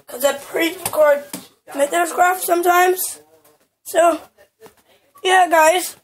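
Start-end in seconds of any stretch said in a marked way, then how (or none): none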